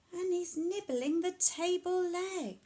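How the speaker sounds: plain reading voice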